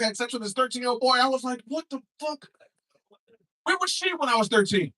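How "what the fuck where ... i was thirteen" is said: high incredulous outburst; jealous disbelief played big; comic reaction to the story; mock outrage. Retold own reaction.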